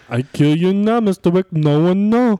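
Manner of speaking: mock Serbian movie-villain accent. Podcaster showing off to co-hosts as a film villain, Serbian accent. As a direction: threaten Mister Wick in a put-on Serbian accent. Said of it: "See, I can do Serbian".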